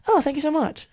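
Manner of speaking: a hint of a polite British thank-you; British accent. Fan recalls singer thanking her, British accent. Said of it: "in her little Brit-, British accent".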